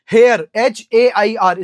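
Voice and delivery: slow, letter by letter: hair, H A I R; slow spelling demo. Shown as a demonstration. Teacher spelling the word slowly for the class. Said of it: "spelling में कभी confuse मत होना".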